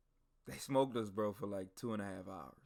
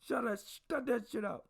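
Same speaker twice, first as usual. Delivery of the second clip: higher, stern drill-sergeant bark; drill sergeant scolding; 9 semitones higher than usual. Retold or imitated. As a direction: drill sergeant telling groaning recruits to quit acting. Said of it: "Drill sergeant says".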